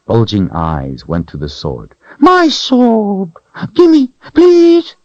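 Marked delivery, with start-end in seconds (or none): 2.2-5.0 high, loud grasping plea; greedy creature. A narrator's reading. Creature begging Richard for the sword. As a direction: claw at it greedily.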